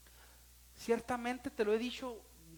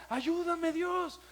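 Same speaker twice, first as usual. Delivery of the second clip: high, loud, slow pleading cry; imitating a desperate hospital plea; retold cry for help; 6 semitones higher, 5 dB louder, slower than usual. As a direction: beg God in pain. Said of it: "de veras era esa expresión".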